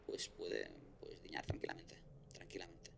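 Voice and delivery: breathy hushed whisper, dropped right down. Real, not acted. Telling his co-host the scary part, whispered. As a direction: lean in and whisper it like a secret. Dramatic aside about dying of fear.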